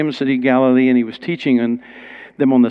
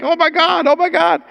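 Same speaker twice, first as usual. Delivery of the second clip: much higher amazed "oh my God"; stunned exclamation. Retold or imitated. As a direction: gasp it out in awe. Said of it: "he was like".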